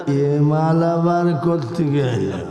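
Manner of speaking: slow, low mock contempt; disdainful mullah. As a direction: draw it out like a sneering rival cleric. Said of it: "এক মোল্লা আর এক মোল্লার দিক তাকালে".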